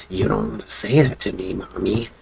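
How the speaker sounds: quiet, low, menacing murmur; creepy possessed kid. Retold or imitated. Possessed kid threatening his mom, creepy. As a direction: murmur it softly like a possessed child. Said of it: "really, like, psychotic shit".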